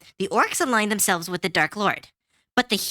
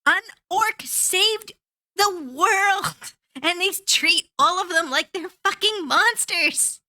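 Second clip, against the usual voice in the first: high, rising outrage, some words drawn out slow; exasperated outburst, voice pitched high; real indignant rant; 8 semitones higher, slower than usual. Real, not acted. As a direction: pitch climbing with fury at the film. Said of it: "I'm so mad".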